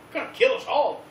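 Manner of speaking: lightly acted crowd alarm; alarmed pleading. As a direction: beg them to stop, playfully panicked. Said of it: "they were like".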